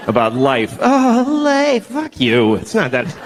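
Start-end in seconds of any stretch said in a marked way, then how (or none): 0.8-2.5 high, slow melodramatic cry; overwrought. Retold or imitated. Mocking people too excited about life, a high slow melodramatic wail. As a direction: wail it at the sky. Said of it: "People get too excited".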